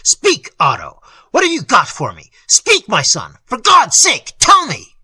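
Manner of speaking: loud, high desperate cries; frantic father pleading with his son; desperate pleading cry. A narrator's reading. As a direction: beg him to speak, voice cracking high. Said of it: "the old man's vibrating accents".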